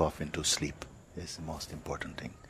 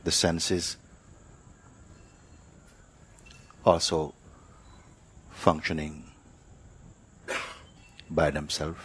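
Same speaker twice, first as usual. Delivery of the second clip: guided meditation; 3 dB quieter than usual. Acted. Teacher leads sitters to notice body and breath, unhurried meditative delivery. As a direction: guide them gently inward, leaving silence between phrases.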